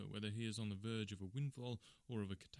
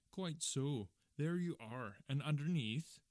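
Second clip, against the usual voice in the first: triumphant; 4 semitones higher, 4 dB louder, slower than usual. Acted. Salesman triumphantly presses point.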